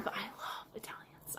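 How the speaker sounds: very soft, voiceless whisper. Real, not acted. Streamer gushing to viewers about loving Italians, whispered. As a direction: whisper it, barely any voice.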